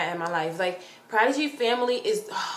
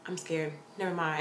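small, low, soft voice; scared retreat; 3 semitones lower, 9 dB quieter than usual. Retold or imitated. Fan freezing halfway to Prodigy, scared and quiet. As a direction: back out quietly, suddenly timid. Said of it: "I stopped and I freeze".

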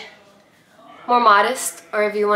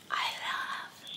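whispering; 15 dB quieter than usual. Real, not acted. Whispered aside.

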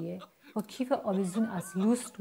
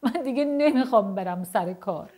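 loud, higher-pitched mock sobbing wail; tearful outburst; 4 semitones higher, 7 dB louder than usual. A narrator's reading. Dad bursts into tears at breakfast, mock sobbing. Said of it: "با حق حق گفت".

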